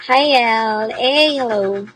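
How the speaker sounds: ordinary talk, nothing marked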